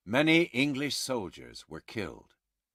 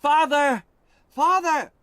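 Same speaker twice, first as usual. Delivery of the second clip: high, loud calling out; loud high desperate call; shouted; 8 dB louder than usual. Acted. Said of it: "Alice shouted".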